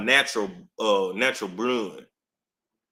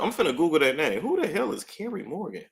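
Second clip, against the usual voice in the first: ordinary talk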